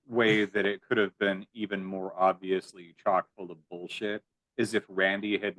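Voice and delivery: ordinary talk, nothing marked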